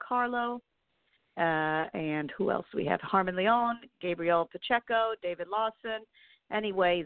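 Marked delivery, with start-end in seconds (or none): none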